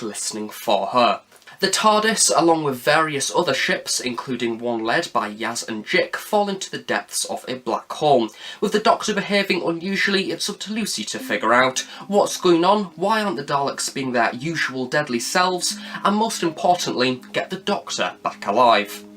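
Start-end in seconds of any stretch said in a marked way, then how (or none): none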